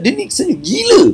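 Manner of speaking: very high-pitched mock bystander; gossiping onlooker. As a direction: imitate people calling someone crazy, way up high. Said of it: "Orang cakap".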